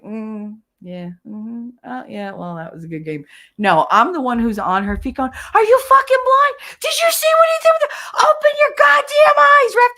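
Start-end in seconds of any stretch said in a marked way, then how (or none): 0.0-3.2 soft, bored, polite murmur; mock polite viewer. Mocking a calm sports viewer, soft and bored. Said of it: "be like". 5.6-9.9 very high, very loud enraged yell; screaming at the ref. Retold or imitated. On her feet at the referee, enraged screaming. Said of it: "screaming at them".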